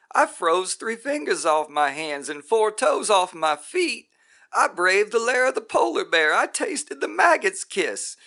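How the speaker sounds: high, loud, furious rant; raging tirade. Acted. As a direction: shout every hardship in his face. Roy rages at the guru.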